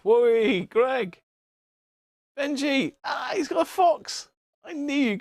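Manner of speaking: high, whooping cheer; excited cheer. Real, not acted. Streamer cheering viewers and the fox, excited whoop. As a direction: call out names in high-pitched delight.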